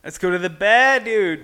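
mock Boston accent, R dropped in bar. Shown as a demonstration. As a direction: do a Boston guy heading out drinking. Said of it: "can you do the Boston accent?".

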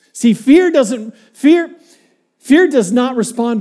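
emphatic build. Real, not acted. Preacher building to a point.

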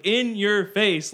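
loud, high, drawn-out taunt; triumphant taunt, stretched out; retold gloating. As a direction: rub it in, slowly and loudly. Retold gloating at dealer after winning.

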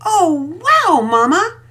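put-on a girl shouting with delight at a gift; excited shout. A narrator's reading. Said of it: "Penelope shouted".

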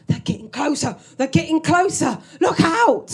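building from plain to a loud shout; rising alarm. Retold or imitated. Old man in the trench yelling warnings at his carer. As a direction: warn of the bombs, rising to a yell. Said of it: "And he goes".

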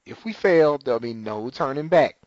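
usual speaking voice

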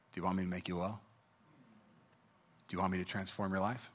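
very soft, low, searching voice; hushed divine question. Retold or imitated. The Lord questioning the pastor over his Bible, hushed.

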